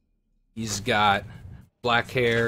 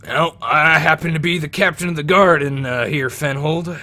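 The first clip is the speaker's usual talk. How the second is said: higher, louder proud captain; guard captain; 5 semitones higher, 8 dB louder than usual. Acted. Captain of the guard introduces himself, loud and proud. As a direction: announce your rank with pride.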